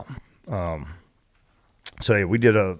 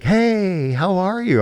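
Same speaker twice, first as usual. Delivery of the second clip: high, louder sing-out greeting; little sweet voice; 11 semitones higher, 6 dB louder, much slower than usual. Retold or imitated. Sister-in-law greeting him on the sipper phone, mock sweet high voice. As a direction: imitate a sweet caller, pitched way up. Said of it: "little sweet voice on the other side".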